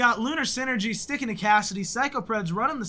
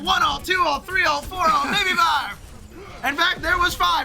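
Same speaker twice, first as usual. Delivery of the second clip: auctioneer; 7 semitones higher than usual. Pretend. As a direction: count bids like an auctioneer. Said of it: "I feel like an auctioneer".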